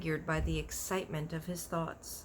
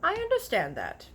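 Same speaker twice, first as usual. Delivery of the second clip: giant's voice; 11 semitones higher, 6 dB louder than usual. A narrator's reading. BFG voiced in a story.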